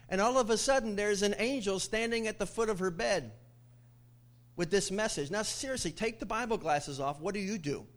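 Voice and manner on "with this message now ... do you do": ordinary talk, nothing marked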